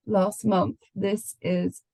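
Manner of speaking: normal talking voice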